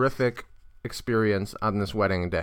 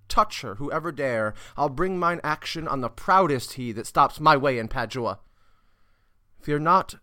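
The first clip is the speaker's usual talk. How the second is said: raised stage challenge easing into reassurance; theatrical verse, settling down at the end; protective Petruchio; 4 semitones higher than usual. Acted. Petruchio's speech, challenge then reassurance.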